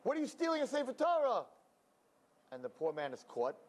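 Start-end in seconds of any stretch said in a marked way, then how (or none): 0.0-1.4 high, fast accusing cry. Retold or imitated. Rabbi catching a supposed thief, high fast accusation.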